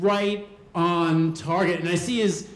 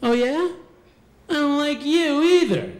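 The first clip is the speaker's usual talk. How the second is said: mocking retort; 7 semitones higher, 3 dB louder than usual. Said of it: "bully retorts to the kid".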